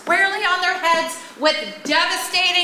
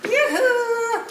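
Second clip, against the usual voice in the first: sing-song greeting; 6 semitones higher than usual. Acted. Calling out as Gloria arrives.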